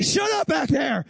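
high, slow mock yell; yelling dad. Dad snapping at kids while reading a map, yelling. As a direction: bellow it like an irritated dad. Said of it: "he's yelling at you to shut up".

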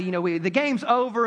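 ordinary talk, nothing marked

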